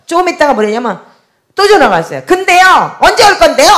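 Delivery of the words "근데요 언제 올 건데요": high, loud impatient demand; yelling. Retold or imitated. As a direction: imitate an irritated caller yelling. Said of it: "또 그러고 소리를 지르는 거예요".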